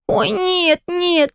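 a light mock wail of protest; child's cry. A narrator's reading. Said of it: "закричал я".

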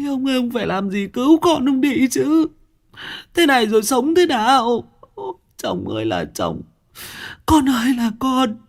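a mother wailing for her son; sobbing high-pitched lament. Acted. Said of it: "ôm lấy ông hét toáng lên".

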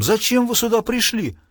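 high, menacing demand; threatening. A narrator's reading. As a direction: demand an answer with a threat behind it. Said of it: "ещё более угрожающим тоном".

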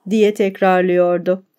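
usual speaking voice

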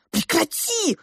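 high, exasperated cut-in; angry protest. Acted. Father cutting off mother's accusation in a quarrel, exasperated. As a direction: cry out to stop the accusation. Parents quarrel over daughter's beauty.

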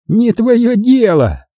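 high, drawn-out rebuff; irate dismissal in a high pitch; angry retort. A narrator's reading. Хорд snaps back in quarrel.

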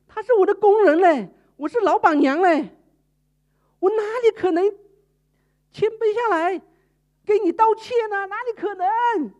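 high, loud haughty protest; the boss lady refuses to stoop; proud refusal. Retold or imitated. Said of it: "他一直在挣扎". (老板娘傲慢地拒绝)

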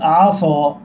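"عصى" with a heavy emphatic ص and dark vowel; slow "عصى", thickened instead of light س; sin turned sad. Shown as a demonstration. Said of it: "وكذلك كلمة عسى ربكم". ("عصى" بصاد مفخمة بدل السين)

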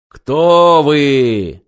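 loud booming question from above; roaring monster voice. Acted. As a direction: roar it like a giant machine. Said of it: "проревело над головой".

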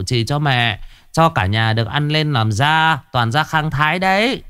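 calm the ghost like a sly medium; coaxing shaman. Acted. Chí Béo soothes the ghost.

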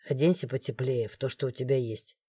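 low, soft, stern male order; stern guard. A narrator's reading. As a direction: give a quiet strict order. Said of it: "строго сказал он". (строго, тихо и низко)

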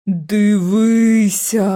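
very slow, looming otherworldly murmur; hooded figure's murmur. A narrator's reading. The hooded ghost urges George to look, slowly. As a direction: drag it out like a ghostly lure. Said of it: "пробурмотіла постать у каптурі".

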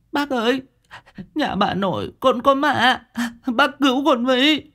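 put-on very high little-boy voice, tearful pleading; sobbing child. A narrator's reading. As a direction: beg for help like a sobbing frightened boy. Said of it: "nó nức nở".

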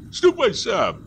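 high-pitched protest; plaintive retort. A narrator's reading. Forester tells hunter to go alone, high protest. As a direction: throw it back in a high voice. Forester snaps back in quarrel.

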